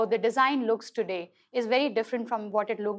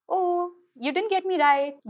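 higher-pitched imitation of a gleeful kid; sneaky child; 8 semitones higher than usual. Kids giving sneaky looks after a false positive, sneaky. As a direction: lightly mimic a child who got away with it. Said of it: "when they're like, sneaky looks".

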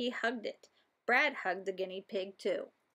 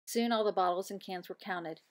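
usual speaking voice

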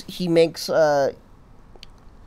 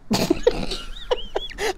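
breathy laugh; 3 dB quieter than usual. Real, not acted. Laughing at own rant.

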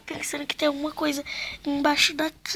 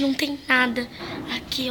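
normal talking voice

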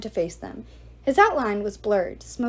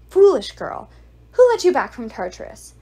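much louder, higher angry bark; furious scolding; 3 semitones higher, 9 dB louder than usual. Acted. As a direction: berate the clumsy servant. Ghoul berates servant.

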